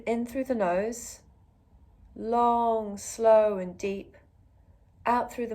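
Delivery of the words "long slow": drawn-out vowels on each word; long and slow. Shown as a demonstration. Yoga teacher guiding the in-breath, long and slow.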